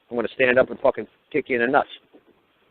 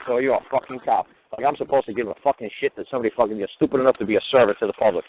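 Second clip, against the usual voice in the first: normal talking voice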